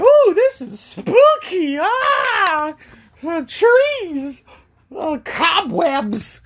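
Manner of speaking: very high, slow falsetto wail; mock terrified. Friends spooked by the woods, mock terrified. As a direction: wail each sight in hammed-up fright.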